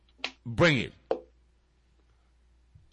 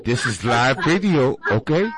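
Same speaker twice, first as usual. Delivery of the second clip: radio announcer; 8 semitones lower, 3 dB louder than usual. Pretend.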